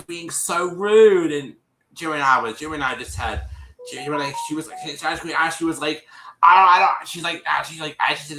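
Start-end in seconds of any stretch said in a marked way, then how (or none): 6.4-7.1 loud, higher-pitched mimicked protest; disrespectful retort. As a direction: imitate her rudely cutting someone off, loudly. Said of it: "Just being really disrespectful".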